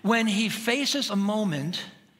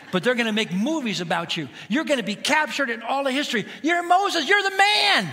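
rising higher and louder into a shout; building to a shout; 6 semitones higher, 4 dB louder than usual. Acted. God hyping Moses, building to excited shout. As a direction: crank the praise up to a yell. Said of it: "time to build him up".

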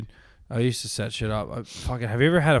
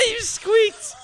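high laughing tease; 5 dB louder than usual. Real, not acted. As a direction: squeal it through laughter. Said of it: "laughing teasing".

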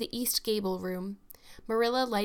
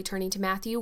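plain reading voice

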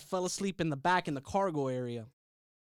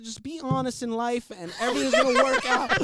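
mom; 6 semitones higher, 5 dB louder than usual. Retold or imitated. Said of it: "My mom said".

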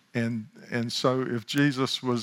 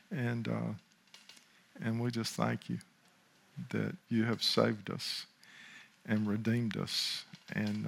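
softer, unhurried closing prayer; 6 dB quieter than usual. Real, not acted. Pastor giving thanks to God, in hushed closing prayer. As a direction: let the prayer settle quietly toward the amen.

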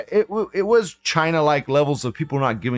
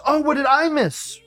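mock whine; 11 semitones higher than usual. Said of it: "This German says".

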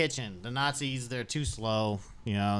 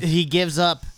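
louder and higher, a big mock-dramatic declaration; dramatic announcement; 6 semitones higher, 9 dB louder than usual. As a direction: announce the defeat like a game-show host. Player repeats the dramatic quitting line.

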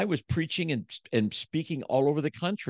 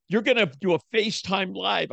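wife's order; 9 semitones higher, 3 dB louder than usual. Retold or imitated. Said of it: "She goes".

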